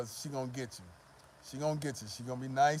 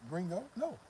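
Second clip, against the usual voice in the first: put-on higher, teasing girl; higher mock girl dismissing a gringo; 5 semitones higher than usual. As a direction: tease the foreigner in a put-on voice.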